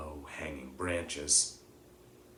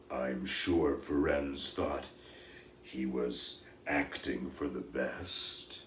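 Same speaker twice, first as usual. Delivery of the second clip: gloomy voice; 4 semitones lower, slower than usual. Acted. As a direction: lower and slow, heavy-hearted. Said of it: "he said in his gloomy voice".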